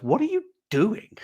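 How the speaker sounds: high, puzzled "what are you doing"; wife's incredulous question. Retold or imitated. Wife baffled at his fan con idea, high incredulous. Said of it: "my wife looks at me and goes".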